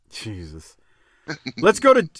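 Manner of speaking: ordinary talk, nothing marked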